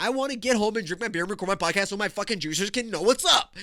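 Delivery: worked-up, comic frustration; frustrated rant. Real, not acted. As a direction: vent it in one rising run. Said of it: "because I'm frustrated at this point".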